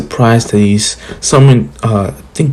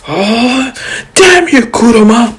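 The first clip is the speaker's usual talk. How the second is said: Shukaku's angry reply; 12 semitones higher than usual. Retold or imitated. Both Shukakus curse Kurama together, very high and indignant. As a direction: squeal his outrage at Kurama. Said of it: "Shukaku's like".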